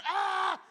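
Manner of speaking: loud high scream; hammed-up loud shriek; another scream. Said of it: "And then one more".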